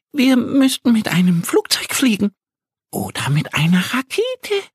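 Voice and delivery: high little duck voice, eager; Annie's voice. Acted. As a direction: pitch it up as the small duck with an idea. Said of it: "sagt Annie".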